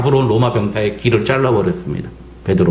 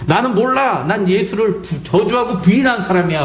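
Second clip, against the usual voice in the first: panicked denial; 8 semitones higher than usual. Retold or imitated.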